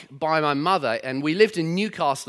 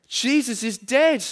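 put-on higher, slower Geordie little girl, sad; sad Geordie child; 7 semitones higher, 3 dB louder, slower than usual. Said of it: "butcher the Geordie accent".

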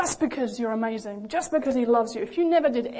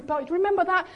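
higher-pitched, insistent objection, lightly acted; protesting child; 5 semitones higher, 4 dB louder than usual. A child confessing to the Father, mildly anxious protest. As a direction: butt in, pitched up and pleading.

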